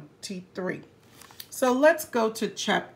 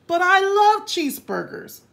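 whiny protest; 7 semitones higher, 5 dB louder, faster than usual. Acted. Hippo protests.

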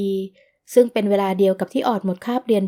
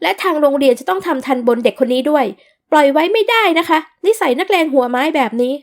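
indignant demand; 5 semitones higher, 5 dB louder than usual. Acted. Mother demands punishment.

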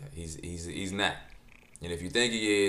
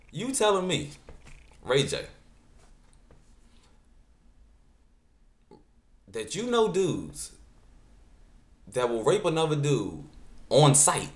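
incredulous; 6 semitones higher than usual. Real, not acted. Outraged questioning of [name].

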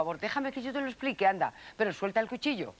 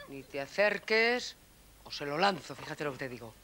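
low, softer murderous threat; quiet menace; 7 semitones lower, 3 dB quieter than usual. Acted. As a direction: mutter the threat darkly. Twin threatens with a knife. (amenaza baja y contenida)

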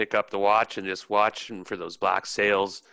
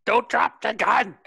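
high, loud, drawn-out warning yell; urgent yell; 12 semitones higher, 4 dB louder than usual. Acted. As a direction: shout it in alarm, pitched way up. Crewman yells from crow's nest.

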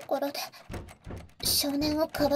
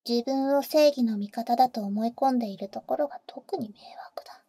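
annoyed whisper. Acted. Complaining to the boy about hunters, annoyed whisper. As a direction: whisper your contempt for the hunters.

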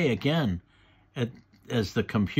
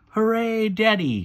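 put-on high, loud child's cheer; excited shout; 10 semitones higher, 5 dB louder than usual. A narrator's reading. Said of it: "shouts Peppa".

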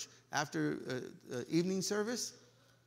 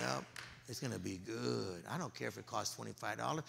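inner thoughts; 9 semitones lower, 11 dB quieter than usual. Acted. Acting distracted churchgoer's inner thoughts.

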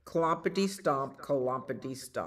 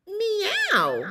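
very high, loud meow; cat meow; 6 dB louder than usual. Pretend. Storyteller springing the cat on children, high meow. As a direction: imitate a cat's piercing meow. Cat noise in picture book.

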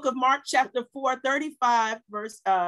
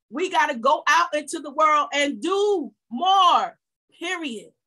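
slow, loud shout building to "more"; preaching shout; 3 dB louder than usual. Real, not acted. Pastor urging her church outward, slow loud shout. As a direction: call the church out into the world, full voice.

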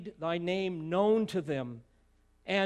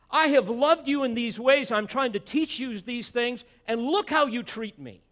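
pretend angry outburst; 7 semitones higher, 9 dB louder than usual. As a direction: lose your temper in a hammed-up rant. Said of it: "To which Jesus replied".

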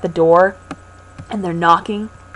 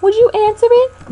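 mother voice; 5 dB louder than usual. Acted. Voicing mother.

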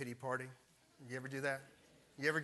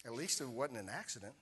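gentle inner voice. Retold or imitated. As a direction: let each at least land gently. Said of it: "this one thought comes in".

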